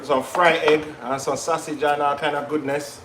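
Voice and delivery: ordinary talk, nothing marked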